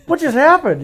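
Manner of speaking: high, loud retold bewilderment; mock-panicked outcry; stunned disbelief. Hunter retelling his reaction to a missed shot, stunned and loud.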